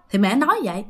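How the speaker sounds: plain reading voice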